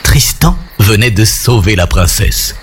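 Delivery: usual speaking voice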